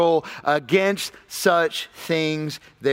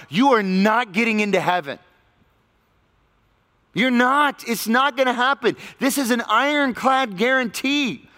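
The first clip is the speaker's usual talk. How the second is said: emphatic warning; 6 semitones higher than usual. Real, not acted. Forceful warning from pulpit.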